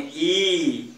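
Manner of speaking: ई held long and slow; long vowel. Shown as a demonstration. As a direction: stretch ई out as the long ii. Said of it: "बड़ी बोलेंगे".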